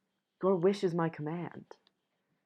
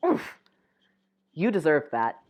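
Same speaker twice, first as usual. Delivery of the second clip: usual speaking voice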